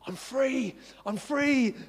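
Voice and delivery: a lightly voiced mother's high, joyful cry; overjoyed cry. Mum running out, overjoyed.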